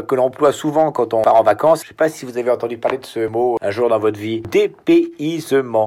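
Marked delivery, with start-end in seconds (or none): 4.5-5.9 Dépaysement stretched syllable by syllable, very slow; Dépaysement pronounced like a lesson for a slow pupil; Dépaysement, dragged out like Morse code; spelled out slowly. Shown as a demonstration. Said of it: "vous commencez déjà à parler en morse".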